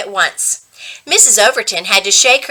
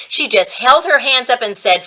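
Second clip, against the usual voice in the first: plain reading voice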